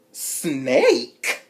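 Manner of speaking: loud rising disbelief; outraged echo. Retold or imitated. Loud disbelieving echo of Joker's insult. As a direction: throw the word back.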